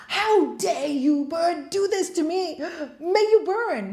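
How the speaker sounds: furious, rising to a high loud shout on "May you burn"; outraged swami cursing; angry curse. Retold or imitated.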